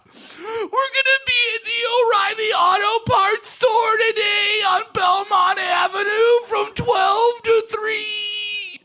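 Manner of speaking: very high, wailing sob through an ad read; hammed-up tearful radio promo. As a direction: cry your way through the station promo. Heartbroken DJ reads promo while crying.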